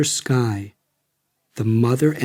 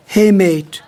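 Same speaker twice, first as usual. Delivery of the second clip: higher and louder friendly greeting; cheerful exclamation; 7 semitones higher, 6 dB louder than usual. A narrator's reading. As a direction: hail a long-lost mate with relief. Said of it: "exclaimed Ramsey".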